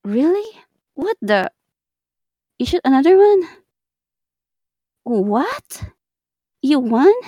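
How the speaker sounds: high, loud disbelief; surprised. Real, not acted. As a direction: react in shock. Shocked at kills.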